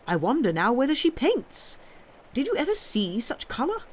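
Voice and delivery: snide bishop's wife. Acted. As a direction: raise the voice in mock disbelief.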